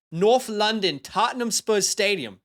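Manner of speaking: put-on a quick, hammy stab at a London accent; jokey mock English accent for the London game; bad British accent. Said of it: "That was a terrible accent".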